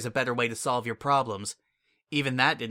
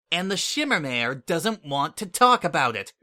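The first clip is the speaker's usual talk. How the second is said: snapped; 4 semitones higher, 5 dB louder than usual. A narrator's reading. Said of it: "I snapped".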